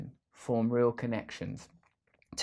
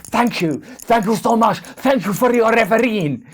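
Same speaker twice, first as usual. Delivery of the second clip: high, loud mock-grateful thanks; over-the-top sarcastic football manager; sarcastic Pep Guardiola; 9 semitones higher, 12 dB louder than usual. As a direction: sneer thanks at the referees like an angry manager. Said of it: "Sorry for that impression".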